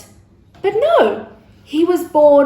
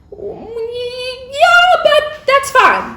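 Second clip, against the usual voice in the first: singsong reassurance; 11 semitones higher, much slower than usual. Acted. Gabriel reassures Mary.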